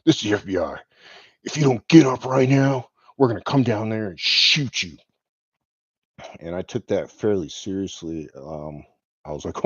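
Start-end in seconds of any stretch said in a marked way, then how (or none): 0.0-5.0 mild mock yell of a threat; retold shouted order, only partly raised; imitated yelling. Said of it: "the guy could yell down at me".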